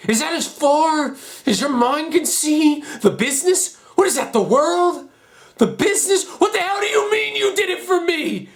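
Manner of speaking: high, loud, escalating rage; furious outburst. Acted. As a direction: build to an anguished, furious cry. Furious confrontation with the father.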